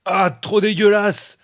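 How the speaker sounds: high, loud, drawn-out revulsion; disgusted outcry. Acted. Player reacting to spiders crawling from the doll, disgusted outcry. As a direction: cry out, grossed out, stretching the words.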